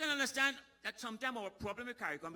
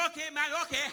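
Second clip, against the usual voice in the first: loud, high shout back; shouted reply; 11 semitones higher, 12 dB louder than usual. Retold or imitated. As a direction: holler the answer back up. Man shouts back, fatally opening mouth.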